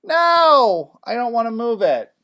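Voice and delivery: high, loud whiny refusal; protesting student. Acted. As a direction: balk loudly with a drawn-out no. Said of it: "and you're like".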